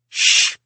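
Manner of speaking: sharp hushed "shh"; shushing. A narrator's reading. Mike shushes the grumbler at the party, sharp shush. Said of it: "replied Mike".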